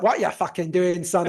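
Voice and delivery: lightly voiced exasperated mum; a hint of a mother telling off her son; mother scolding. Retells his mother scolding him.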